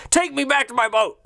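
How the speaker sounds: high-pitched mock indignant order; mocking captain's demand. Captain stranded on the kid's boat, mocking demand. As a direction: mimic a blustering captain making demands. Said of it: "You hear the voice".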